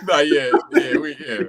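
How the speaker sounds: laughing loudly through the words. Real, not acted. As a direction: laugh while agreeing. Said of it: "don't agree with that".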